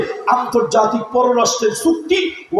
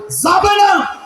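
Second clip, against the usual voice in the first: much higher, shouted যাবে না; shouted repeat; 10 semitones higher, much slower than usual. Real, not acted. Preacher forbidding women to go out, high shout.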